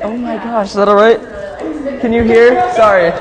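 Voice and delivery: high, loud flustered apology; imitating a fan. Girl on the line apologizing for her laugh, high and loud. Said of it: "She's like".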